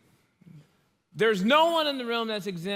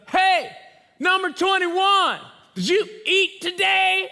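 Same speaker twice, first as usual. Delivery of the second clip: much higher, louder mocking yell; heckling fan; 12 semitones higher, 7 dB louder than usual. Pastor imitating a home fan heckling him, high and loud. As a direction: taunt the skinny away player from the stands. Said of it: "he's just yelling in my ear".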